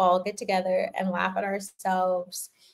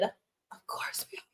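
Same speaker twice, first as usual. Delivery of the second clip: whisper; 15 dB quieter than usual. Real, not acted. Conspiratorial aside to chat.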